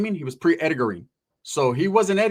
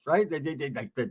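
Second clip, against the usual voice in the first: usual speaking voice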